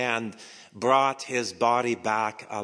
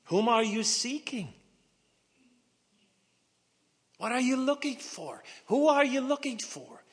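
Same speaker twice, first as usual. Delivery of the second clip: much higher, searching voice; Jesus's question; 12 semitones higher than usual. Acted. Jesus asking Mary whom she seeks, higher voice. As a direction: ask her lightly, high and wondering.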